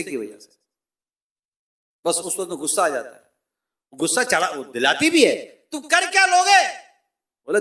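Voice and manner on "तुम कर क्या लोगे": higher, louder taunting jab from the wife. Acted. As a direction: dare him, mocking.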